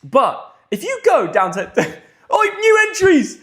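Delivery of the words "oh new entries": high, loud burst of excitement; excited discovery. Real, not acted. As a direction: exclaim it high, thrilled at the find. Spots new entries while scrolling.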